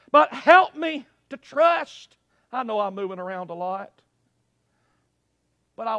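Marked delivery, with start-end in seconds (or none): 0.1-2.2 loud plea held up to the end; full-voiced plea. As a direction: plead out loud without dropping away. Same plea kept at full voice.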